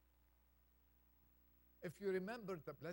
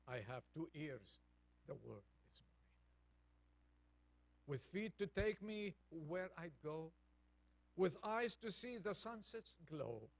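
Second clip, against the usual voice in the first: poem reading. Acted. Reads a poem aloud.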